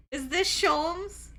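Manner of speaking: loud, slow, rising question; excited disbelief. Real, not acted. Streamer waiting to confirm who it is, excited disbelief. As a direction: ask it big and drawn out.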